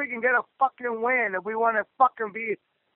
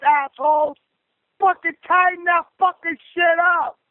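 angry venting rant, pitched up; letting it out; 3 semitones higher than usual. Real, not acted. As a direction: berate the team, each swear word hit hard. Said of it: "Had to let that shit out".

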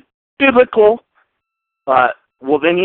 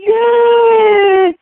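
high shrill-free mock cheer, much higher and louder; 6 dB louder, much slower than usual. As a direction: jump up cheering like a giddy fan. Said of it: "Everybody's jumping up going".

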